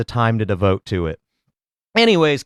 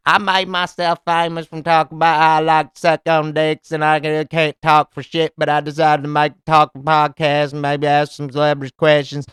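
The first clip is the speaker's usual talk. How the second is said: put-on twangy country-girl voice, barely pausing; hayseed girl; 3 semitones higher than usual. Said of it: "this little hayseed girl".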